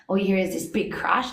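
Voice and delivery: ordinary talk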